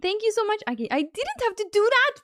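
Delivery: high, delighted thanks; excited gratitude. Real, not acted. Streamer overwhelmed by a viewer's gift, excited.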